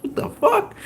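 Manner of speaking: very high, replayed gasp of shock; stunned disbelief. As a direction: squeak it out in mock astonishment. Acting out his stunned reaction.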